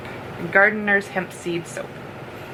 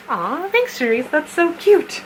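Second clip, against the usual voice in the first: cooing; 7 semitones higher than usual. Real, not acted. Cooing over gift.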